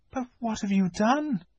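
put-on a child asking gently; curious girl Lucy. Lucy asks the fawn what he did, gentle.

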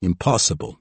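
usual speaking voice

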